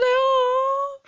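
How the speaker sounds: a high, loud, drawn-out oh; a squealed high aww-like oh; touched surprise. Real, not acted. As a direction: melt at something adorable. Moved by a small boy's question.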